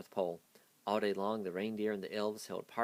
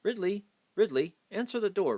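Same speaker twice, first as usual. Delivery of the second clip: calling out; 11 semitones higher, 8 dB louder than usual. A narrator's reading. Stormella calling her butler.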